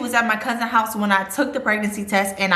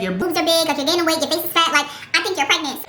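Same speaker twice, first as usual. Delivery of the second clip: a higher, blunt voice for the cousin, listing her points; imitating cousin; 6 semitones higher, faster than usual. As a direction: play the cousin bluntly spelling out the signs.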